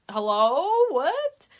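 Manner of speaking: loud, shooting up high on a question; shocked disbelief. Retold or imitated. Imitating Lily after the dunking, loud disbelief. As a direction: mimic an incredulous outburst.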